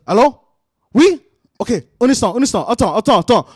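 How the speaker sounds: hurried mock phone voice, stalling someone; fake phone call. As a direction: pretend to take an urgent call. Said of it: "I'm gonna fake a phone call".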